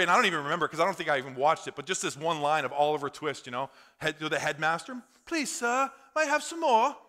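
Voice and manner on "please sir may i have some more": high-pitched English orphan begging; English accent. Acted. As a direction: plead politely in a put-on English accent. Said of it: "That's my English accent".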